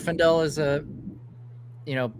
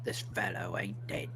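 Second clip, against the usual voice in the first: breathy stage whisper; 8 semitones lower, 12 dB quieter than usual. Pretend. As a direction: whisper it like a secret. Joking whisper about dead body.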